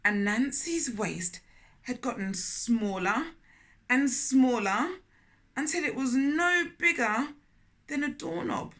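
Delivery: put-on storytelling voice for children; gentle read-aloud storytelling; story narration. A narrator's reading. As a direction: read it like a picture book aloud. Narrating the moral image.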